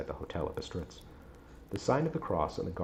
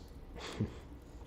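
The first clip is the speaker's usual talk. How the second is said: soft laugh; 9 dB quieter than usual. Real, not acted. Reader laughs at own slip.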